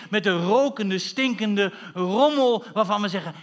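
slow, emphatic pulpit oratory; full-force preaching. Acted. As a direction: build in loud waves, drawing out key words.

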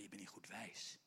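breathy low whisper. Real, not acted. Preacher whispering what they said to Rhoda. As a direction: tell it like a secret.

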